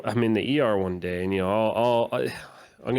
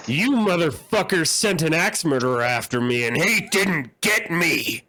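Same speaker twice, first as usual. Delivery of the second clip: loud, higher, slow furious accusation; angry rant; 7 semitones higher, 4 dB louder than usual. Retold or imitated. Patient shouting at her bank over the phone, mock rage. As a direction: spit it at the bank rep. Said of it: "she's like".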